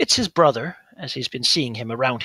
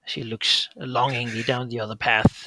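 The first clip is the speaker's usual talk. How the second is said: ordinary talk, nothing marked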